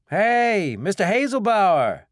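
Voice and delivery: loud call across a field; yelling. A narrator's reading. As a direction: call out at full voice. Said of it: "Tyler yelled".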